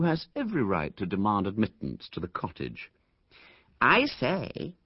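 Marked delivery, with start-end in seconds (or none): 3.8-4.7 higher, lightly mocking a snob's patronizing tone; boy imitating a snob, a touch higher; patronizing imitation. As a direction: put on the superior airs of a spoilt rich boy. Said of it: "a fairly good imitation of the Hubert Laynites' most patronizing manner".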